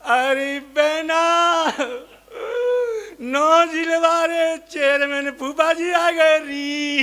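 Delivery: keen while giving chores; mock wailing. A mourning woman wails to her sister to make tea. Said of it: "अब दूसरी रोई".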